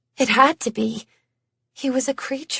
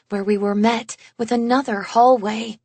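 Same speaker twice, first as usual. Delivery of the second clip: ordinary delivery, nothing marked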